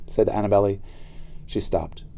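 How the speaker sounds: ordinary delivery, nothing marked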